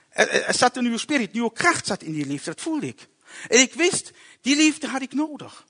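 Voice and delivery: ordinary talk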